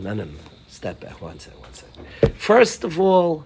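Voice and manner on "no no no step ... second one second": hushed whisper to step back; whispered self-talk. Retold or imitated. Stressed father in a store whispering to himself to calm down. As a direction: whisper it to yourself, calming down.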